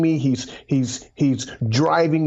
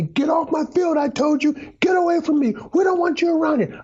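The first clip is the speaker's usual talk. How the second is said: much higher, shouted coach's rebuke; coach yelling; 13 semitones higher than usual. Retold or imitated. The coach yelling at him in front of the team, shouting. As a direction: bellow it like a furious coach on the field. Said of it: "he yelled at me".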